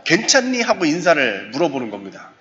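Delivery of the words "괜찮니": friendly check-in. Shown as a demonstration. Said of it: "우리 그 실습해볼까요?".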